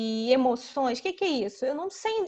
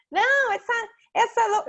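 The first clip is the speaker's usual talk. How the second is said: put-on higher, louder child voice, breaking off; child imitation; 9 semitones higher, 6 dB louder than usual. Child begins complaining about a doll, high and loud. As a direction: start a high, loud kid protest. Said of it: "já vi uma criança falando assim".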